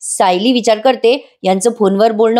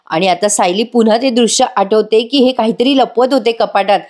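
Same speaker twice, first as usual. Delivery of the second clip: usual speaking voice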